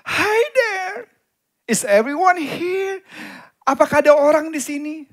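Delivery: put-on high, drawn-out little boy's call; high singing-out "anybody there" call; innocent child calling out. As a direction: call innocently into an empty room, loud and stretched. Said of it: "dengan polosnya dia berkata".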